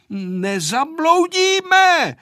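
high, drawn-out cry; very loud call. A narrator's reading. Vojta in the fog to the pilot, very loud call. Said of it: "zvolal hodně nahlas".

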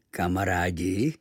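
slow, low, rising question; puzzled repetition. A narrator's reading. Native repeating the boy's new word, puzzled. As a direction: sound out "Kamarádi" slowly as an unknown word. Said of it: "Opakoval to slovo po mně".

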